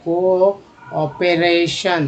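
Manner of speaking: कोऑपरेशन dragged out, को stressed; teacher models कोऑपरेशन slowly; slow demo word. Shown as a demonstration. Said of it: "को, को".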